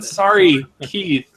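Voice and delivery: usual speaking voice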